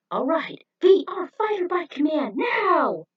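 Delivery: loud, high battle command; commanding shout. Acted. J B summoning his fighter bike, loud command. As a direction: call the bike into action.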